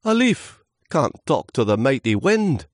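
high, loud, awed voice; quivering little leaf. Acted. Small leaf to mighty wind, quivering protest. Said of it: "Lewis quivered".

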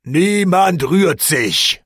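slow, loud barked command; officer silences the tavern brawl, booming; booming order. A narrator's reading. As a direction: thunder it like a drill officer. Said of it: "schrie er mit dröhnender Bassstimme".

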